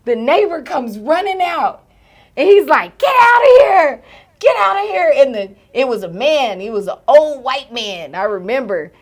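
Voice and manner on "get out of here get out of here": high, loud imitated shooing; acted-out old man shouting it away; neighbor's yell. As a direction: bellow high at a dog, then ease slightly. Neighbor yelling at dog.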